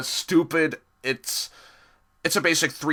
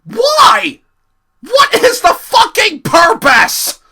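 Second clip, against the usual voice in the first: much higher and louder, slow disbelieving yell; exasperated shout; 13 dB louder, slower than usual. Real, not acted. Reviewer yelling at the episode, exasperated shout. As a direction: bellow your outrage at the screen. Ranting about Percy's whistle.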